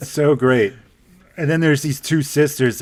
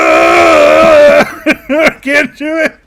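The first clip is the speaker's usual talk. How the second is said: loud mock cry breaking into laughter; hammed-up despair, half laughing; mock wail; 12 semitones higher, 9 dB louder, much slower than usual. Friend mocks a quitting contestant, loud hammed-up wail.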